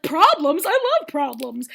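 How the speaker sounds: loud, thrilled outburst that stretches out; mock delighted enthusiasm. Bachelor bonding with her over problems, mock delighted. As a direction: pounce on the word with glee.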